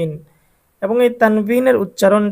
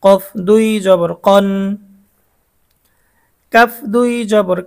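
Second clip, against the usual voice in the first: steady chant-like drill with held endings; repeat-after-me drill. Shown as a demonstration. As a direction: sound out each letter for pupils to follow. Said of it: "সাথে সাথে পড়তে হবে".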